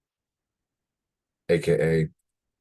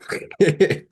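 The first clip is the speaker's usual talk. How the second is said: high squeaky laugh; 14 semitones higher, 6 dB louder than usual. Real, not acted. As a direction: let out a high giggle. Laugh after complaint.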